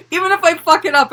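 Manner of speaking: high, loud, rushed mock cheer; mock excitement. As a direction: blurt it out with exaggerated glee. Continues the mock excited performer.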